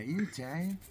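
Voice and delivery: put-on playful New York Italian accent question. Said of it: "That's how they say it in New York, eh?".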